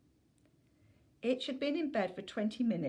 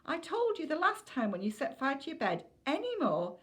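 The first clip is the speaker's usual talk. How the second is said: rising exasperation; 9 semitones higher than usual. Acted. Mum's scolding rising to peak.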